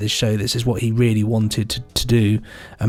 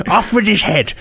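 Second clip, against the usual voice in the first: mock decree; 7 semitones higher than usual. Jokey mock sentence on blasphemer.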